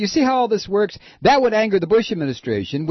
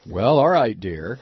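lower, resigned husband reply; 9 semitones lower than usual. Pretend. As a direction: answer flatly as a husband. Husband answers in the skit.

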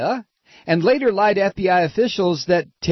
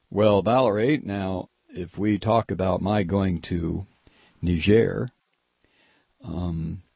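lower, quiet conspiratorial husband; 11 semitones lower, 4 dB quieter than usual. Pretend. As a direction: murmur the plot like a husband. Acted skit of the husband plotting.

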